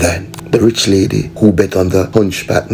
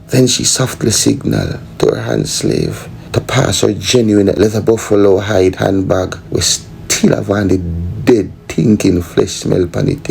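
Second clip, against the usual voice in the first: ordinary talk, nothing marked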